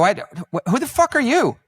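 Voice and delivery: high, loud baffled outburst with a laugh; baffled host. Retold or imitated. Host baffled by unknown guest, laughing outburst. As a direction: blurt it out, laughing in disbelief. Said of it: "I go".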